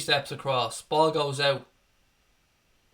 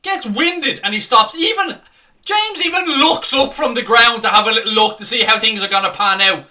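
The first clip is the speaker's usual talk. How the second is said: loud, higher furious rant; angry ranting; 6 semitones higher, 10 dB louder than usual. Real, not acted. Fan rages at ref stopping the counter, angry shouting. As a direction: keep yelling at the ref in real anger.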